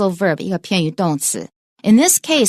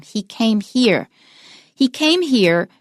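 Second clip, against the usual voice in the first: "here" stressed at the end, slower teacher's model; 3 semitones higher, slower than usual. Shown as a demonstration. Teacher modelling an important place word, stressed "here". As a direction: demonstrate "here" carrying the sentence stress. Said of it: "we will usually stress them".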